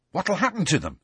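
eager, high-pitched question. A narrator's reading. Ron asks Hermione about the jinx, eagerly. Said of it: "said Ron eagerly".

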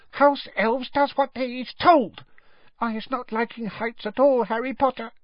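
high, prim elf insistence; firm squeaky elf. A narrator's reading. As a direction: lay down the rule in a squeak. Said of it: "said Winky firmly from behind her hands".

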